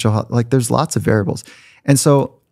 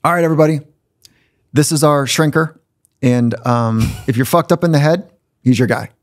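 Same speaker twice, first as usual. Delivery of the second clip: higher-pitched announcement to a team; imitated coach; 5 semitones higher than usual. Coach introducing the new shrink to players, mock announcement. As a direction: play the coach addressing the whole room. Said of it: "and he says, um".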